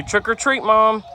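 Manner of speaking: high, loud sing-song prompt; modeling for toddler. Shown as a demonstration. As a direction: coax the child to repeat.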